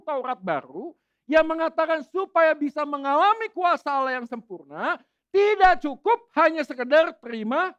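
loud, rousing pulpit delivery; full-force preaching. Acted. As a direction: preach it out to the whole congregation.

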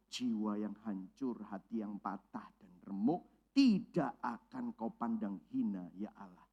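low, soft, slow recitation; scripture reading. Acted. Preacher quoting Psalm fifty-one to the congregation, slow and hushed. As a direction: read the psalm gently and reverently.

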